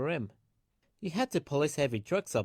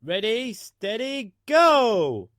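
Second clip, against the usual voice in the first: higher, rising to a loud shout on go; race start shout; 8 semitones higher, 11 dB louder, much slower than usual. A narrator's reading.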